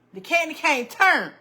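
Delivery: ordinary talk, nothing marked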